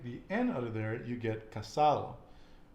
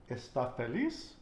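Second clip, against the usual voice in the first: "Está feliz" stressed es-TÁ fe-LIZ with a rise; "Está feliz" as a question, final syllables stressed. Shown as a demonstration. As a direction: punch the ends of "Está" and "feliz". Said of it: "where I'm putting the emphasis".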